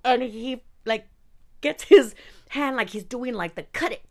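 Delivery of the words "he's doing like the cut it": ordinary talk, nothing marked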